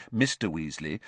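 usual speaking voice

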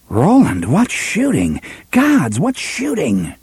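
high swooping pitch of astonishment; amazed. A narrator's reading. Cuthbert gapes at Roland's shooting, amazed. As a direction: let the voice leap up in amazement. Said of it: "Bert's eyes were round and amazed".

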